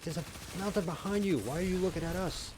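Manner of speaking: high, exasperated mock rant; retold screaming. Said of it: "start screaming at the T V".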